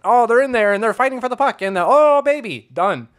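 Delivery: high, loud excited play-by-play call; Bob Cole impression. Host imitating Bob Cole's excited playoff call. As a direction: call it like an old-school playoff announcer. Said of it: "that's what he does".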